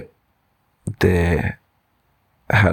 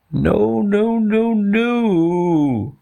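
very high howled refusal; mock howl; 14 semitones higher, much slower than usual. Two animals reject elephant's plan, high mock howl. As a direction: howl it out high, without much volume. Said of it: "howled the other two together".

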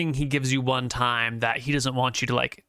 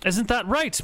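pointed question; 9 semitones higher than usual. Acted. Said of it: "looking at you pointedly".

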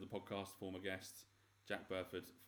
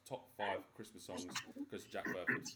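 normal talking voice

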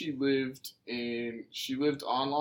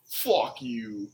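Brooklyn accent. Shown as a demonstration. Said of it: "in a Brooklyn accent".

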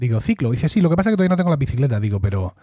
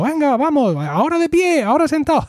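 monitor de ciclo; 10 semitones higher than usual. Retold or imitated.

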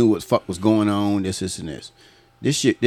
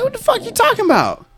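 much higher, baffled question; mock confusion; faster than usual. Teller baffled by coworker, much higher. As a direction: pitch it way up, puzzled. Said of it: "So I'm like".

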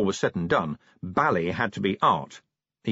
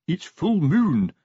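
plain reading voice

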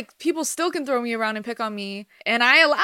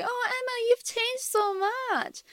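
put-on high, slow, soft Persian-accented relative; badly faked Persian accent; attempted Persian accent; 12 semitones higher, 4 dB quieter, slower than usual. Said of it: "trying to do a Persian accent".

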